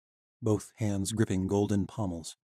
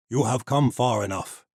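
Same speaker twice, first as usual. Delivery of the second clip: gruff knight; 3 semitones higher, 5 dB louder than usual. Acted. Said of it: "said in a gruff voice".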